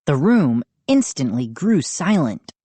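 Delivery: ordinary delivery, nothing marked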